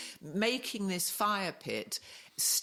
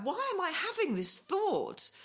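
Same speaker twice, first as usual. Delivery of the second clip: high, drawn-out, incredulous self-quote; retold bewilderment, stretched out high; freaked-out self-questioning; 13 semitones higher than usual. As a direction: ask yourself in high-pitched, slow dismay. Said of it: "And then I'm tripping".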